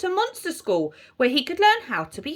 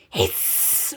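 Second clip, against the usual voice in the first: long, voiceless hiss; vampire hiss. A narrator's reading. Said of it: "went the vampire".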